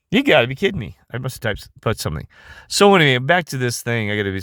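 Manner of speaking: ordinary talk, nothing marked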